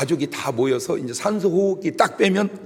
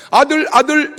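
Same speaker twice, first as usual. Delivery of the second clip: eager; 12 semitones higher, 8 dB louder than usual. Retold or imitated.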